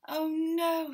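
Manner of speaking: Mister Bear, woken again, wailing high; exasperated. Acted. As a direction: despair at yet another noise. Mister Bear kept awake again.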